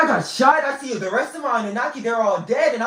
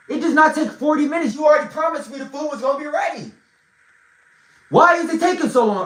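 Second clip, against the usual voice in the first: raised, fast mock-angry complaint; 7 semitones higher, 4 dB louder, faster than usual. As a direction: push the words out higher and harder, fed up. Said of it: "And I'm mad".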